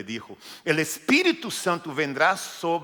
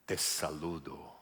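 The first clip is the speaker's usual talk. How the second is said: much lower, much softer grave angel voice; solemn angel; 10 semitones lower, 10 dB quieter, slower than usual. Pretend. Angel greeting María, deep solemn voice. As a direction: announce it like a heavenly being. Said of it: "Creo que está mucho mejor".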